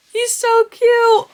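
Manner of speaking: high, loud, drawn-out gush; thrilled squeal of cuteness; squealing with delight. Real, not acted. Gushing over dragon.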